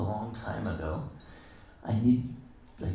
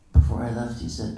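normal talking voice

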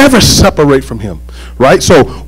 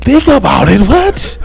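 incredulous echo; 7 semitones higher, slower than usual. Retold or imitated. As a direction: pitch leaping up on a disbelieving question. Retells his disbelief at being put off.

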